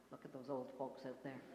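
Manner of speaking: normal talking voice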